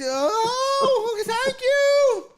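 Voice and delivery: high, hooting thanks; loud aunt. Retold or imitated. Aunt thanks drive-thru worker, loud high hoot. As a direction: sing it out loud and high, imitating her. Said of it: "she was just loud".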